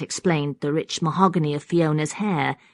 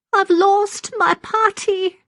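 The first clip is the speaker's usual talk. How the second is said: put-on very high, slow, forlorn old woman; rattling old voice; 6 dB louder, slower than usual. A narrator's reading. Maggie has lost her rag doll, high rattling lament. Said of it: "in her rattling voice".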